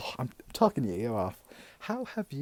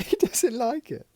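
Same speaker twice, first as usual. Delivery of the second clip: high, loud repeat through laughter; laughing; 12 semitones higher, 9 dB louder than usual. Real, not acted. Amused that Paul dislikes the drink, laughing. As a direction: crack up while saying it. Laughs at her own story.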